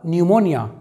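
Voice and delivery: न्यूमोनिया said "nyu-mo-nia"; actual sound. Shown as a demonstration. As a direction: begin न्यूमोनिया with a "nyu" glide, no P. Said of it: "जो actual sound होगा". (न्यूमोनिया को "न्यू" से शुरू करें)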